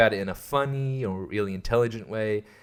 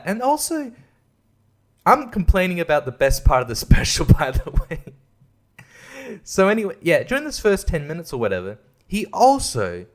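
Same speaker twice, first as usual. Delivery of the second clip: normal talking voice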